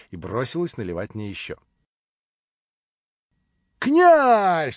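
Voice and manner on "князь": sharp, much higher and louder cry; a man exclaiming at the prince's name. Acted. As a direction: blurt the name out in outrage. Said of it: "вскричал Маслобоев".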